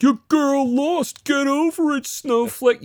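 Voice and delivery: high, loud sneering taunt, slow at first; mocking redhead troll. Mocking an online troll, high sneering taunt. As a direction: jeer it in a squeaky mocking voice. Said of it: "a redhead that's like".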